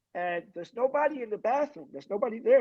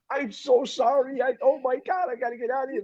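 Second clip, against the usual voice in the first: higher, mock-panicked apology; retold flustered apology; 6 semitones higher than usual. Man apologizing to girl in the bathroom, retold panic. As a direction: act out a flustered escape, pitch raised. Acting out embarrassed apology, escape.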